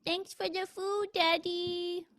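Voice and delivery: a hint of a toddler thanking daddy; baby voice. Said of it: "The baby says".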